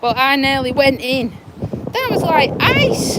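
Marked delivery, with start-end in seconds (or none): 1.9-3.2 high and slow, astonished; amazed. Real, not acted. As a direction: still reeling. After near fall.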